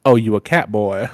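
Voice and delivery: lightly drawled Southern impression; a hint of a laid-back Southern actor; Walton Goggins. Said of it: "in Walton Goggins' voice".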